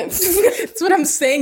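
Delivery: laughing. Real, not acted. Breaks into laughter.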